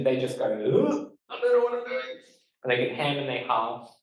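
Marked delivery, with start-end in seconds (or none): 0.5-2.2 high-pitched mock panic of a flustered interviewee; deer in headlights. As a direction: act out freezing at the microphone in a much higher voice. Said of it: "A lot of people can get a bit deer in headlights".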